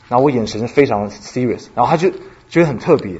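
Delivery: normal talking voice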